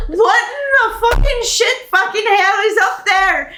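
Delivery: high, loud indignant swearing from below; cursing NPC woman. Acted. Woman tumbled down the stairs shouting up at the intruder. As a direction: yell up through the floor, outraged. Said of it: "you hear".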